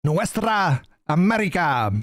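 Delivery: put-on slow, showy Spanish accent with rolled R's; playful mock Spanish accent; imitated Spanish accent. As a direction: draw out the Spanish, mimicking the accent. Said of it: "He's rolling his R's".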